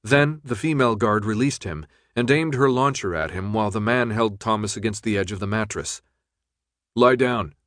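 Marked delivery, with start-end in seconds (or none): none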